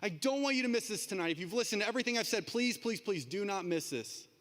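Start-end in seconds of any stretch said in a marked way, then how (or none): none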